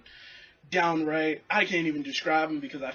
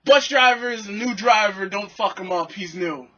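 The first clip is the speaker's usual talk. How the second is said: firm plea; 4 semitones higher, 8 dB louder than usual. Retold or imitated. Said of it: "stand up for the right thing".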